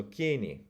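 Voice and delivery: kini with the first syllable stressed; KI-ni, falling away on the second syllable; accent on the front. Shown as a demonstration. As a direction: hit ki in kini. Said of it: "has the accent up on front".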